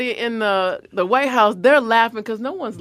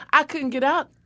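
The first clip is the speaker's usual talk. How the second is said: ordinary talk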